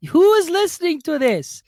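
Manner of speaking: put-on Oscar Kokoschka-ish voice; vague cartoon accent. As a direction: hold a mixed cartoon accent. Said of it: "which accent is this even supposed to be".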